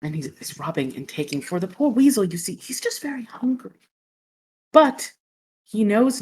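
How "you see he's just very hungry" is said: hushed aside. Acted. Retelling sad puppet story.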